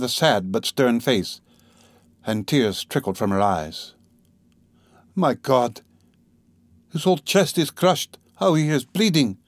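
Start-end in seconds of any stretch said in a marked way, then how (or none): 5.1-9.4 raised, anguished cry over the injured man; a narrated wife's despair, voiced higher; despairing exclamation. A narrator's reading. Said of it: "she said in despair".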